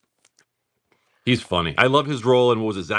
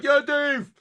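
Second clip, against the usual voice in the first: put-on drunken yell. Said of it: "some asshole in the crowd going".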